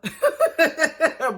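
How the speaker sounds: loud high laugh; laughing at her own thug impression; burst of laughter. Real, not acted. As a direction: crack up at the bit.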